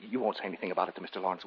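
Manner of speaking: ordinary talk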